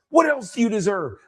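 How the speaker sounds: high, pressing coach's question; retold rallying challenge; intense, assertive. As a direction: demand an answer to spur them on. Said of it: "way more intense and way more loud".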